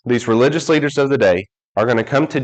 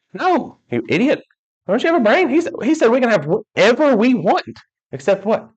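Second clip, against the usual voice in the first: mock scolding; 10 semitones higher than usual. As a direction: scold him like he's a fool. Scolding the foolish passenger.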